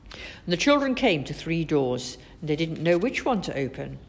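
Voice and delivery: plain reading voice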